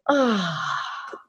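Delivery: long soft "ah" sigh; relaxing sigh. Shown as a demonstration. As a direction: breathe out an easy ah. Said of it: "and then just go".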